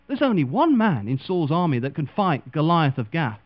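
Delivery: ordinary talk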